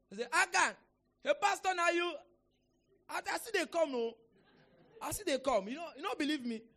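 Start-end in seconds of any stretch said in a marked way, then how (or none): 0.2-0.7 loud, high shout of a name; retold startled pastor's shout; acted calling out. Acts out calling Akan on floor. 1.2-6.6 loud, high mock protest in Pidgin; hammed-up caught-out drinker; imitated drunken convert. Voices drunk convert caught lying down.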